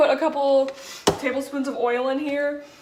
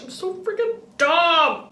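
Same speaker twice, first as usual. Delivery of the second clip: half-joking whiny sob, slow and higher; fake-cry over the ruined pancakes; a touch of hammed-up crying; mock whimper; 6 semitones higher, slower than usual. Garlic pancakes ruined, self-pity.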